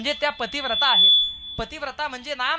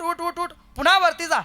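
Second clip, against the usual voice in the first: higher, hurried "उठ, उठ, उठ"; urgent urging; 6 semitones higher, 5 dB louder than usual. Acted. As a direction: urge him up at once. Tukoba urging God to rise.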